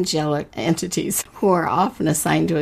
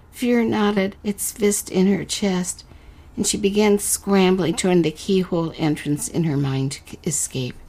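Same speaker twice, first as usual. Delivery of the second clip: unhurried storyteller reading a fairy tale; story narration; 4 semitones higher than usual. A narrator's reading. Host reading the letter-writer's story to listeners, narrating. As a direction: read it like a bedtime tale.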